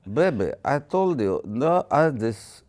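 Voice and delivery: a lightly put-on Russian accent, pleading; mock Russian accent, coaxing the woman home. [name] in a Russian accent tells Anastasia to return, lightly put-on Russian accent. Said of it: "Russian accent".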